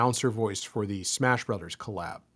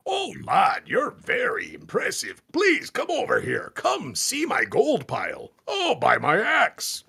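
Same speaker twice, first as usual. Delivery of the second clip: much higher, louder hammed-up D and D dwarf; 12 semitones higher, 5 dB louder, slower than usual. Shown as a demonstration. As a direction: boom it out like a jolly dwarf showing off his gold, slowing at the end. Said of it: "when I do a dwarf voice".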